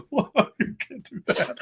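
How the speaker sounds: high, soft, drawn-out mock sob; mock blubbering. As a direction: imitate yourself falling apart in tears at the movie. Retelling his tearful reaction to film.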